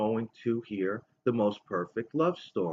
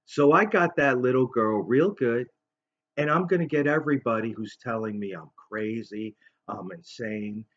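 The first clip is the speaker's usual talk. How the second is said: normal talking voice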